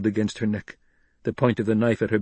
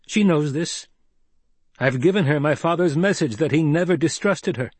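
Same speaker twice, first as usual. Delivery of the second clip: higher, emphatic assurance; earnest Paul; 6 semitones higher, 4 dB louder than usual. A narrator's reading. Paul vouches for his mother to Gurney, emphatic. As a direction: state it firmly as proof.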